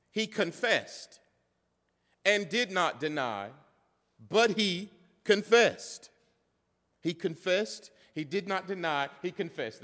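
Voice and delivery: "he confessed" repeated with deliberate pauses; emphatic repetition. Shown as a demonstration. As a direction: hammer "confessed" and "did not deny" phrase by phrase. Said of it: "That's emphasis if you don't know".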